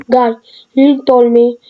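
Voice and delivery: ordinary talk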